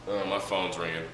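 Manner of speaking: usual speaking voice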